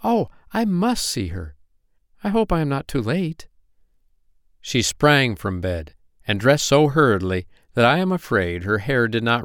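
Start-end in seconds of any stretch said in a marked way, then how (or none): 0.0-3.5 high, anxious eagerness; high child-fairy voice, keen and worried; eager little fairy. A narrator's reading. Poppy Pink afraid she is too late, high and eager.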